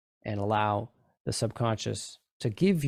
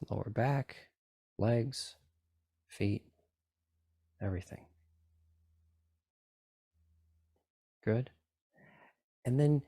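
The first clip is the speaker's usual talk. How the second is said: guided meditation. Acted. Continues the relaxation induction.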